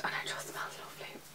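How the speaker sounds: hushed dreamy whisper; blissful whisper; whispered swoon. Real, not acted. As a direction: breathe it out adoringly. Swooning aside.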